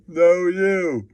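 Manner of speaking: ordinary delivery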